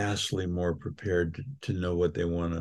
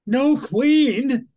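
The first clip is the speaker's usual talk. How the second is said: put-on very high, louder child's voice, astonished; shocked little girl; 8 dB louder than usual. Daughter hearing there is no queen, high astonished child voice. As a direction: squeal it in disbelief like a small girl.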